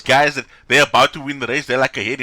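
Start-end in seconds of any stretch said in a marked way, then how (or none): none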